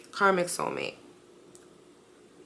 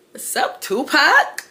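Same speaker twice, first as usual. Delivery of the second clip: teasing greeting; 8 semitones higher, 8 dB louder, slower than usual. Retold or imitated. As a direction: tease her about the bandages with a big smile. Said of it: "Brooklyn has the biggest smile".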